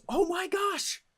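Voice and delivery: high surprised realization; epiphany gasp. Retold or imitated. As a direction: sound like it just clicked. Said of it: "I need them to say to themselves".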